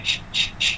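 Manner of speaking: breathy unvoiced ch hiss; soft airy ch puffs; airy ch sound. Shown as a demonstration. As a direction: push out a puff of air, ch ch ch. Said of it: "Itulah bunyi angin dia".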